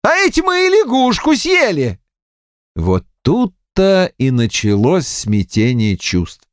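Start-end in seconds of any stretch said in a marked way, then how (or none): 0.0-2.0 high, appalled realisation; shocked exclamation. A narrator's reading. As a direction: let horror push the voice up high. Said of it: "воскликнул потрясённый Аким Бесхлебнов". (потрясённо, высоким голосом)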